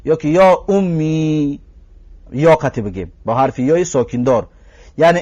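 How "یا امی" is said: «یا امی» stretched very slowly, syllable by syllable; vocative demo. Shown as a demonstration. Teacher showing students the call, very slow.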